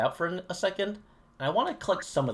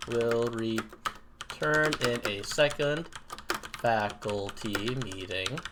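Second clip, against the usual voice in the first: slow, stretched-out words; dictating while typing; 2 semitones lower, much slower than usual. Real, not acted. Streamer typing a break notice for viewers, slow dictation. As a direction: say each word slowly as you type it. Types out text while saying it.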